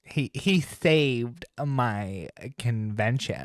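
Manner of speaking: slow, stretched mock gush; exaggerated put-on drawl at a crawl; Slay voice. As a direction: mimic with drawn-out, theatrical vowels. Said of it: "I am gonna do the Slay voice".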